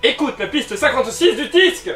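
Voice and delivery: high, loud announcer call; loud announcement. Acted. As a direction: announce it with a big flourish. Reads the card instruction.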